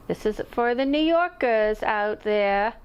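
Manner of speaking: a loose, hammed-up attempt at a New York accent; mock New York accent. To New York viewers, raising her cup in a mock New York accent. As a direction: toast the New Yorkers in a half-botched New York accent. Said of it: "Why did I do this accent? That's not a New York accent".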